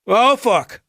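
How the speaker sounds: high, sudden yelp of fear; sliding off the hull, yelping in panic; panicked curse. A narrator's reading. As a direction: cry out as you start to slip. Jake sliding toward the edge.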